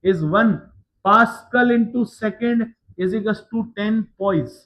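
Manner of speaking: very slow, word by word, for students to write down; slow dictation. Shown as a demonstration. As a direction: dictate it slowly so the class can copy each word. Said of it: "एक note point के लिए". (नोट लिखवाने के लिए बहुत धीरे बोलना)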